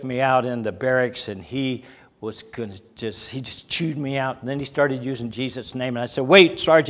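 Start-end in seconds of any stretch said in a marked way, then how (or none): 6.2-6.9 raised, louder interjection; urgent protest. Retold or imitated. Airman objects to sergeant's swearing, protesting urgently. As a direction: cut in to stop the sergeant.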